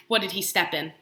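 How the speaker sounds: ordinary delivery, nothing marked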